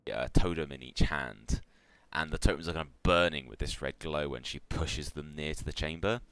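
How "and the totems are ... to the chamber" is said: ordinary talk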